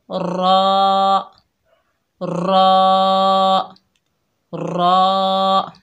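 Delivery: thick, rounded रॉ; trilled, heavy रॉ; heavy raw. Shown as a demonstration. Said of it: "हमारी आवाज़ ऊपर को उठेगी".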